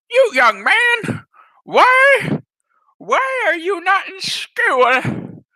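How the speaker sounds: high, slow scolding granny; old lady voice. Retold or imitated. Old lady scolding the limping boy, high. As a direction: quaver like a nosy old woman.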